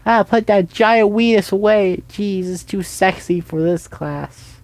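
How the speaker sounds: high, loud mock shriek from a teacher; hammed-up teacher yelling at the class; mock teacher scream. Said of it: "screaming at me".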